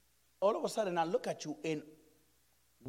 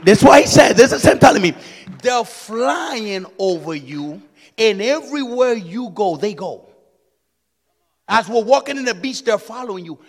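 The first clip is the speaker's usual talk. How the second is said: loud preaching with rising tune; preaching shout; 14 dB louder than usual. Acted. Preacher to congregation about the guarding angels, loud. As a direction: drive it home to the crowd.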